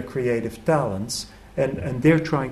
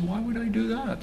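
higher, softer lazy fisherman voice; fisherman shrugging off advice; 10 semitones higher, 5 dB quieter than usual. Retold or imitated. Fisherman answering the German tourist, higher and softer, unbothered. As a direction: toss it off like a man in no hurry.

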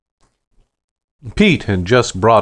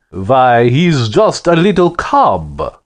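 big, loud, higher Russian bellow; booming Russian accent; 6 semitones higher, 4 dB louder, slower than usual. Acted. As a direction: boom it out in a thick Russian accent. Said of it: "his voice boomed like a backfiring car with a Russian accent".

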